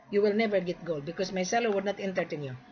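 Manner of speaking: ordinary talk, nothing marked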